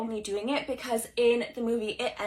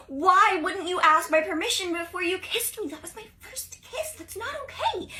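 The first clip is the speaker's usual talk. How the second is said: put-on upset girl; 7 semitones higher, 4 dB louder than usual. Girl confronts crush after kiss.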